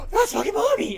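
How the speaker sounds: high, loud excited cry; excited squeal. Retold or imitated. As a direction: squeal with joy at mommy. Cats excitedly greeting owner.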